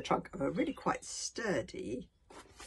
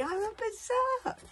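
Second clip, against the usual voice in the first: awed revelation; slower than usual. A narrator's reading. Fishlegs thinking it.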